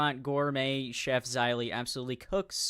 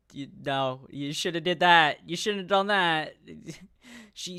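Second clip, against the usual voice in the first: ordinary delivery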